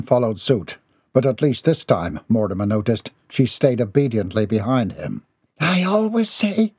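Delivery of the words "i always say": high, slow grumble; muttering mother. A narrator's reading. Mother huffs along behind son, high slow mutter. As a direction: drag it out in a high voice. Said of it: "she muttered as she huffed along".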